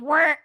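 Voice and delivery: a loud little mechanical whirr; mock whirring noise. Imitating her face twitching with a whirr sound. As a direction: make the sound your eye makes. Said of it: "my eye goes".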